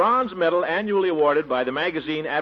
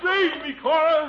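shouting for help; 8 semitones higher than usual. Acted. Still in the water.

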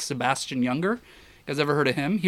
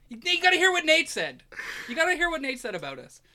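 much higher mock call, the last line faster; imitating a teasing call; 13 semitones higher than usual. Photographer teasingly calling Gary over, much higher. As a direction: stir up the friend across the yard.

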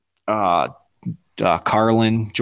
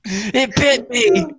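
mock panic quote; 11 semitones higher, much slower than usual. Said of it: "quoting the movie".

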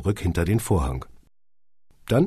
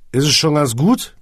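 put-on slurred, louder drunk question; town drunk; 8 semitones higher, 7 dB louder than usual. A narrator's reading. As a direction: slur it like a drunk. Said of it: "lallte Hagen".

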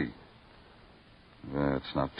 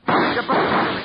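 higher, louder sharp warning; urgent warning shout; 12 semitones higher, 4 dB louder than usual. Acted. Detective warns his partner under fire, shouting. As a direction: push them back fast.

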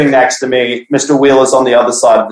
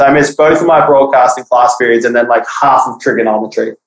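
ordinary delivery

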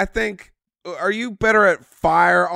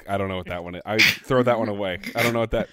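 ordinary delivery

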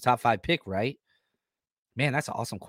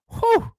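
high excited whew; 5 dB louder than usual. Real, not acted. Admiring red jerseys.

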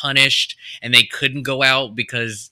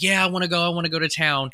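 eager; 6 semitones higher, much faster than usual. Retold or imitated. Friends eager to go out.

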